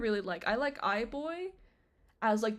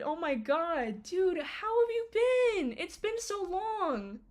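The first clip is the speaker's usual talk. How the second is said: much higher, slow and happy; excited greeting; 8 semitones higher, slower than usual. Real, not acted. She greets her old friend, high and excited. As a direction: welcome them back warmly.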